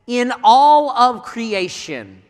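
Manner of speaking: "in all of creation" drawn out slowly, weight on "all"; slow emphatic stress. Real, not acted. Preacher to congregation, slow heavy stress on "all". Stressing the most pivotal moment.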